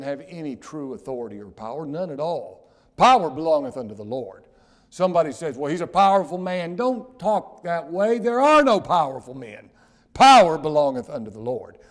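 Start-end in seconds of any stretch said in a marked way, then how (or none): 3.0-11.8 loud, high rebuke; preaching at full force. Acted. Preacher rebuking the congregation, loud and high. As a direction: thunder it at the pews.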